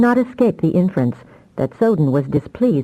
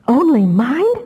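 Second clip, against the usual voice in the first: gasped question, higher; stunned gasp; 8 semitones higher, 4 dB louder, slower than usual. Acted. Damia stunned by the news about Soden, gasping. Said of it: "she gasped".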